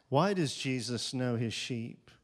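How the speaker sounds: ordinary talk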